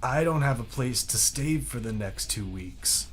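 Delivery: slow, quiet lament. Retold or imitated. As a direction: whine about having nowhere to stay.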